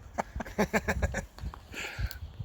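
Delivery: an unvoiced burst of laughter; breathy laugh. Real, not acted. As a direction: laugh it off, amused at yourself. Laughing at the noisy game.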